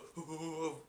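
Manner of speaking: soft mock stoner chuckle; Seth Rogen weed laugh. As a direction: imitate a stoner's laugh, quietly. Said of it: "sound voice".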